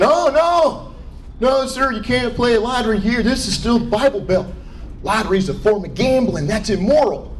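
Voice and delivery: disapproving clerk. Retold or imitated. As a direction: refuse like a righteous gas station attendant. Acts out disapproving gas station clerk.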